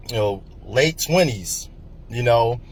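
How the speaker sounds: ordinary talk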